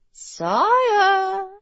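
high, drawn-out nurse's call; nurse's plea. A narrator's reading. Nurse addressing the bedridden king, high coaxing call. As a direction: draw the title out high and pleading.

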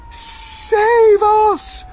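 very high, loud wail; panicked cry. A narrator's reading. Spider cries to be saved while falling, shrieked plea. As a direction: plead at full voice. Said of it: "cried Miss Spider".

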